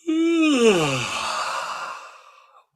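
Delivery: very high loud yawn slowly sinking; an enormous cat-like yawn; big guided yawn. Shown as a demonstration. Coach leading the second yawn, high and loud. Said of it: "try to".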